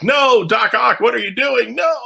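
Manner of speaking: high, loud frantic ghost cry; ghost Peter protesting. Retold or imitated. Ghost Peter shouting at Doc Ock, high frantic protest. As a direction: yell helplessly at Doc Ock. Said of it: "like, silent narrator".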